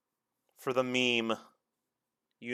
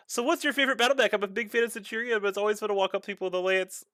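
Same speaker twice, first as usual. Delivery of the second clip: fast reading aloud; 5 semitones higher, faster than usual. Retold or imitated. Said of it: "I was just like".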